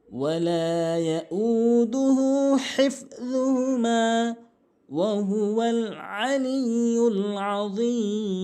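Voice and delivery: slow chanted tajweed recitation; Quran recitation. Acted. Teacher recites the verse for students, slow melodic chant.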